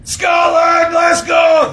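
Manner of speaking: high, loud fan shout; Scottish fan cheering on the team. Retold or imitated. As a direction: belt it like a football fan. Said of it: "They're always like".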